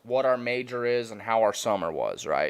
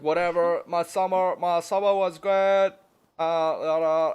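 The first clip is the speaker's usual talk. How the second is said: higher, mushy voice that can't say its R's; imitating a speech impediment; 6 semitones higher, 5 dB louder than usual. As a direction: put on a speech impediment, pitched up. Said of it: "the dude can't say his R's".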